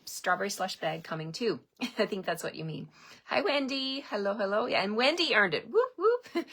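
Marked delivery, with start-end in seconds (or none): 5.7-6.4 high, gleeful whoop whoop; excited whoop. Real, not acted. Host cheering a viewer who earned the bag, high excited whoops. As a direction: celebrate her win with a squeal of a cheer. Celebrating Wendy earning the bag.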